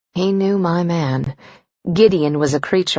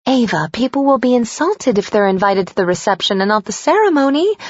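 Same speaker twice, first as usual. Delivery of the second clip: high, anxious, pushy mom voice; a worried mother scolding her daughter; fretful mother; 7 semitones higher than usual. Acted.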